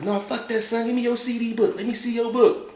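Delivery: insistent high mock demand; high-pitched imitation. Homeboy demanding Wayne's CD book, high-pitched. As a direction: imitate a friend insisting, voice pushed up. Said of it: "high pitch".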